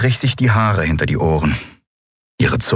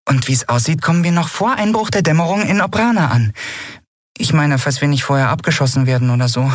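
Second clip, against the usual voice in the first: high, perky encouragement; trying to cheer up; 8 semitones higher than usual. A narrator's reading. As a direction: keep the good news upbeat. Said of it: "bemühte sich um einen aufmunternden Tonfall". (bemüht aufmunternder Tonfall)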